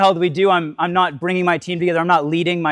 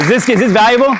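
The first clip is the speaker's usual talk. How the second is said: loud, high and excited; 6 semitones higher, 6 dB louder than usual. Real, not acted. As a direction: fire up the crowd. Asking audience excitedly.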